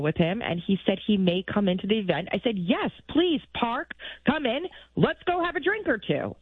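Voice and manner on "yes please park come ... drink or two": higher, eager, retold invite; eager invitation. Producer retells inviting the driver in for drinks, eagerly. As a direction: wave him in to join the party.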